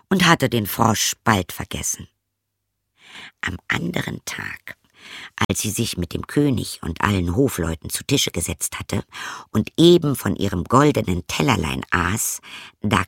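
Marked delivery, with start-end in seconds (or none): none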